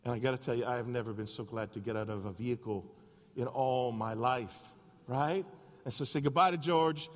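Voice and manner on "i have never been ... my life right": ordinary talk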